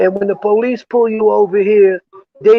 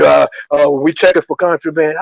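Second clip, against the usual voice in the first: police officer; 4 semitones lower, 3 dB louder than usual. Retold or imitated. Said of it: "And he said".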